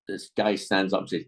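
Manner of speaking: ordinary talk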